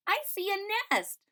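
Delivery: put-on high, bright child's cry; excited child. A narrator's reading. Child spotting the nest in the tree, high excited. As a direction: spot it and point it out. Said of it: "one of the children said".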